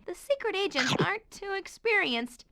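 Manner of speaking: normal talking voice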